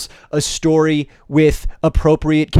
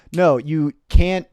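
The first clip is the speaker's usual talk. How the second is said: normal talking voice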